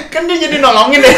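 high, loud, through real laughter; laughing while talking. Real, not acted. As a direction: barely hold back the cackles. Teller cracks up at own story. (ngomong sambil ngakak)

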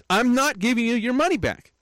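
high, snippy repeat of her refusal; mocking the cashier. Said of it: "Just like that".

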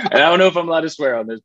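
quick, high, still laughing; fast laughing aside. Real, not acted. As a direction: talk through leftover laughter.

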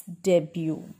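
"debut" as DEB-yoo, no final T; correct silent T. Shown as a demonstration. Teacher asking learners to repeat, debut as DEB-yoo. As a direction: say "debut" ending in "byoo". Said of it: "All of you repeat after me".

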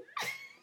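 sudden high-pitched embarrassed laugh; high squeal laugh. Real, not acted. Laughs at his rejection.

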